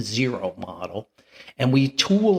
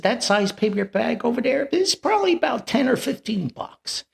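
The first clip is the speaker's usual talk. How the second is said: peddler voice; 11 semitones higher than usual. Retold or imitated. Peddler quotes a price.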